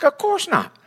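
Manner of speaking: high, incredulous of course not; mock-offended no way; indignant refusal. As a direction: squeak it out in disbelief. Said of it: "I'm like".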